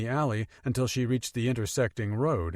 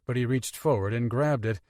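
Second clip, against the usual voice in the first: ordinary delivery, nothing marked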